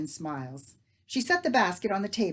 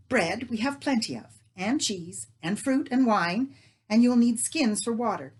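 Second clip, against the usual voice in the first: eager planning; 5 semitones higher, 8 dB louder than usual. Acted.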